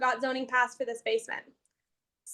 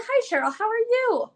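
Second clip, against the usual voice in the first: mock-sweet, higher-pitched hello; overly sweet greeting; 8 semitones higher than usual. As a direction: greet her with exaggerated politeness, pitch lifting. Greeting weary plans examiner in retold visit.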